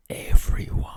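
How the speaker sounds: breathy whispered repeat of the last word; whispered aside. Real, not acted. Vlogger whispering a mocking aside to the viewers. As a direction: drop to a whisper for a sarcastic echo. Whispered echo of his own line.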